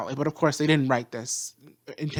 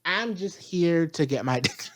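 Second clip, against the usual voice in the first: careless drawl; 4 semitones higher, 9 dB louder than usual. Retold or imitated. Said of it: "Mercury is like".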